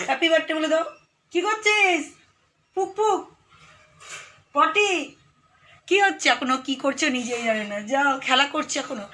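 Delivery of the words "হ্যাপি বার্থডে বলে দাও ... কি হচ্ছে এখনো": very high, louder playful cooing. Real, not acted. Mother teases her toddler in very high baby talk.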